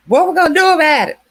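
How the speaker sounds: loud, high, rushed mock yell; hammed-up frantic shout; imitating his yelling. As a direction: bark it out fast like the panicked man. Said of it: "that was screaming out".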